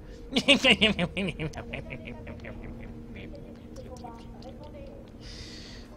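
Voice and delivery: breathless evil cackle; diabolical laugh. Pretend. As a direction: cackle until out of air. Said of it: "C'est mon rire diabolique".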